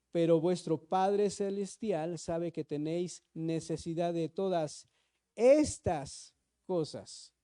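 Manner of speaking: "estas cosas" drawn out very slowly; slow weighty read of "estas cosas"; slow emphasis. Shown as a demonstration. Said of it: "¿te das cuenta que dice estas cosas?".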